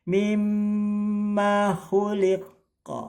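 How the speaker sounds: Mimma khuliqa with mm held long, slow and high; held doubled m. Shown as a demonstration. Teacher reciting for students, mm held. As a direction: sustain the mm in Mimma. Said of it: "ini juga sama nih mim tasdid".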